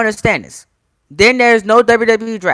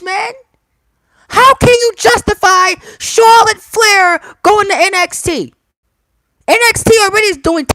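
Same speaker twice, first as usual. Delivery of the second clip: heated rant climbing higher; angry rant; 11 semitones higher than usual. Real, not acted. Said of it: "Let me calm down".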